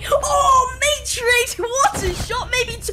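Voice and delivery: high, loud hype yell; excited shouting. Real, not acted. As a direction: scream the play like a caster. Caster hypes a clutch shot.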